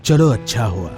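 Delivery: ordinary delivery, nothing marked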